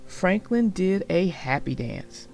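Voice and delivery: ordinary delivery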